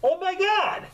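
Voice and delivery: high, slow laughing jeer; passer-by laughing at the costume; mocking onlooker. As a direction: point and laugh at the clown. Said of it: "Anybody looking at me being like".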